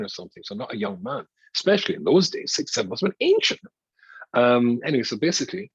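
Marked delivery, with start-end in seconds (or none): 2.5-3.6 mock amazement. Real, not acted. Joking about old age.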